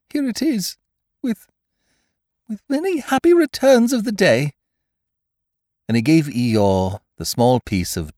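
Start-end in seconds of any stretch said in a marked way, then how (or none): 0.1-4.5 very high, halting squeak; unhappy Piglet. A narrator's reading. Piglet giving Eeyore the damp rag, halting squeak. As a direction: offer it haltingly in a tiny animal voice. Piglet presents the burst balloon.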